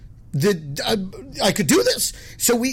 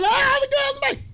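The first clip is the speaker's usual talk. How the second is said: imitating the brother squealing it out very high through laughter; brother laughing. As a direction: mimic a brother dying of laughter. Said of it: "my brother Mark starts dying".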